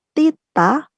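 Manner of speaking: "tita" with breathy aspirated t sounds. Shown as a demonstration. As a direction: push air after the t in "tita". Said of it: "Jadi, untuk yang". ("tita" beraspirasi)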